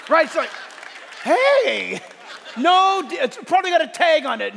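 loud, high, excited preacher; excited preaching. Acted. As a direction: shout it out with joy to the crowd. Excited preaching about new body.

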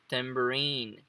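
tambourine drawn out on the last syllable RINE; stress on final RINE. Shown as a demonstration. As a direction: slowly lean on RINE in tambourine. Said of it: "aqui vai entrar a entonação". (tambourine com a tônica no rine final)

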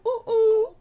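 high squeaky mock Sora yelp; imitating Sora. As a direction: squeal it like the game character. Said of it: "Did Sora really go".

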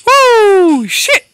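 high, loud startled whoop; a real surprised woo; startled yelp. Real, not acted. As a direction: jump out of your skin and yelp. Sudden startle in game.

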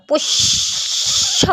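পোষ্য stretched very slowly, the ষ doubled, shoshsho; slow doubled demo. Shown as a demonstration. Teacher to students, slowly sounding out পোষ্য's doubled ষ. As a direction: draw out পোষ্য with a long double ষ. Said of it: "সেটাকে আমি double করে উচ্চারণ করবো".

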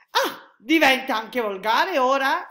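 loud, high, drawn-out offence; indignant exclamation. A narrator's reading. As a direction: exclaim as if affronted. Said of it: "esclamò il militare". (esclamazione scandalizzata, forte e acuta)

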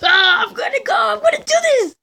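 high, loud outburst; worked-up gamer yell, climbing high; frustrated shout. Real, not acted. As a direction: shout it with rising desperation. Frustrated outburst while retrying the game.

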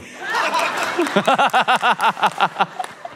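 long, high rapid cackle; mischievous laugh. Acted. Prankster dad after typing a creepy message, cackling. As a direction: cackle at your own prank.

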